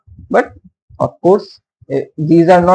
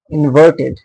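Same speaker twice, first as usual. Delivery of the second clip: normal talking voice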